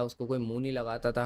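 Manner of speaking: normal talking voice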